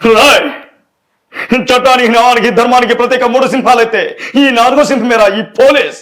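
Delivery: booming film dialogue delivery; Sai Kumar impression. Shown as a demonstration. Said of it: "Sai Kumar గారిది".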